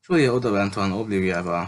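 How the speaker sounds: ordinary delivery, nothing marked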